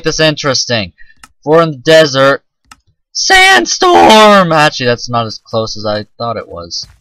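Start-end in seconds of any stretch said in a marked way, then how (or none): 3.1-4.5 drawn-out, high, loud exclamation; mock-epic cry, stretched long; dramatic shout. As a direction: belt it out like a big announcement. Mock-dramatic cry at desert weather.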